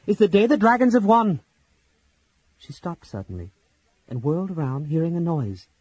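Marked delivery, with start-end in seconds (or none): 0.1-1.4 loud, high vow; swearing aloud. A narrator's reading. Laurana alone in the cave swears a loud, high oath. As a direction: fling the oath out defiantly. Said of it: "she swore aloud".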